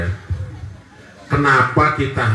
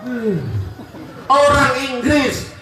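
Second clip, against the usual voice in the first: fiery preaching; 11 semitones higher than usual. Acted. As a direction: preach it up at full force to the crowd. Preacher rouses the congregation. (ceramah berapi-api dengan suara tinggi dan lantang)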